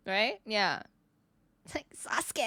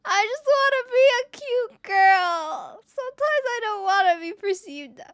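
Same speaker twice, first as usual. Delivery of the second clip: hammed-up tearful squeal; mock wailing; 9 semitones higher, 5 dB louder than usual. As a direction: whine it out in exaggerated despair. Mock wail after being misgendered.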